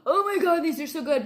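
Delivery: gushing with delight over food; excited raving. Retold or imitated. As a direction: rave about how good it tastes. Husband raving while eating hot wings.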